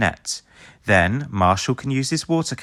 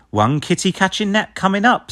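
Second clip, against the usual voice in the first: higher, louder eager pup; eager reply; 10 semitones higher, 3 dB louder than usual. A narrator's reading. Chase answering Ryder, higher and louder. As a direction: answer keen and bright. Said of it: "says Chase".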